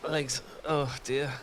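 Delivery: ordinary talk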